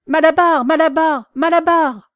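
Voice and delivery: loud high call; crying out a name. A narrator's reading. Mare calling after Malabar, loud and high. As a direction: shout after the van. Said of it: "criait-elle".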